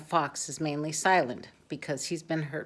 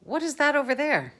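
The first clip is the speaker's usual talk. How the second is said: high, fast wondering call; excited boy; 10 semitones higher, 6 dB louder, faster than usual. A narrator's reading. Boy spotting the wild, high and fast. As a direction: point out the wild in a bright rush.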